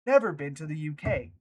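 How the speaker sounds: ordinary talk, nothing marked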